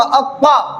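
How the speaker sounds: heavy, full-mouthed emphatic consonant in अक्का; मोटी आवाज. Shown as a demonstration. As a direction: say अक्का thick and rounded, towards "aw". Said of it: "मोटी आवाज में". (अक्का को मोटा, भरी आवाज़ में)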